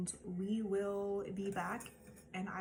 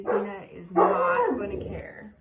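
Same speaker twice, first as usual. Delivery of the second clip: loud, slow, stressed; emphatic; 13 dB louder, slower than usual. Real, not acted. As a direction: lean hard on not. Talking about the dog, emphatic.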